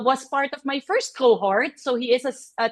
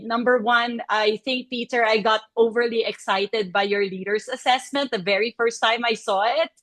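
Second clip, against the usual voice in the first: ordinary delivery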